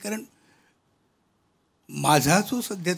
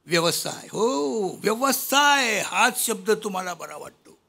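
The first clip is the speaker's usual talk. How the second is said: raised, measured verse delivery; poem recitation; 5 semitones higher than usual. Acted. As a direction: recite rhymed verse to an audience. (कविता वाचन, चढ्या सुरात)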